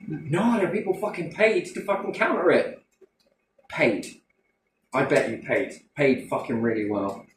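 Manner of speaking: ordinary delivery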